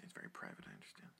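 hushed mock-secret whisper. Teasing about private Snapchat.

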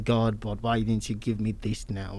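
ordinary talk

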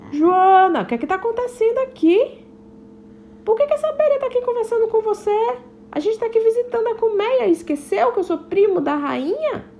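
high, quick questioning father ladybug; a dad demanding answers; lightly voiced father, puzzled; stern ladybug dad. Father bee questions Joana.

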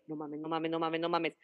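breathless low no mames over and over; hushed panicked repetition. Retold or imitated. Friends seeing her ex, hushed panic. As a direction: whisper the panic under your breath.

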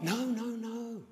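high mock placating plea; hasty placating. Uncle stopping nephew telling Mum, high placating voice. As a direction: hush a tale-telling kid. Uncle hushing the winded boy.

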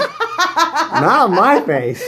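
high, slow laughing denial; laughing protest. Real, not acted. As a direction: laugh it out, stretching it. Mocking laughter.

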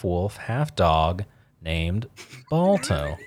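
movie-trailer build to Balto; slow trailer cadence on Balto; dramatic cadence. Real, not acted. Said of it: "you said that with the cadence of".

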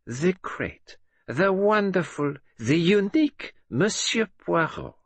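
slow, high, sneering mockery of his titles; mocking. A narrator's reading. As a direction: drip sarcasm over every title. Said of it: "in a low, mocking tone".

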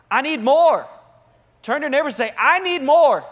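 fervent revival cry, rising to a peak; impassioned preaching. Real, not acted. Preaches at rising full force.

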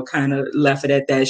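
ordinary talk

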